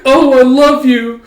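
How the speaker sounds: hammed-up swoon; swooning girl. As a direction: melt into the words.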